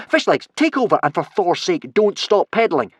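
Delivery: high, loud urgent command; urgent order. A narrator's reading. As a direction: order a crewmate to take over. Alarmed orders to crewmate.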